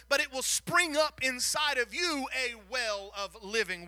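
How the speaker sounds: loud, building sermon delivery; preaching. Acted. Preacher proclaiming living water to the congregation, full force. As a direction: drive the promise home to the congregation.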